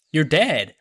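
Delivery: loud snapped dismissal; mock yell telling him he's finished; imitating a harsh old doctor, loud and sharp; angry old doctor. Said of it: "And she's like".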